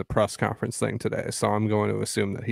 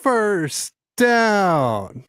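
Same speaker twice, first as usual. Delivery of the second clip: high, loud drawn-out stadium shout; crowd scream; 11 semitones higher, 5 dB louder than usual. Shown as a demonstration. Co-host showing the Rams crowd chant, screaming. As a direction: scream it like a fan in the stands. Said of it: "you scream".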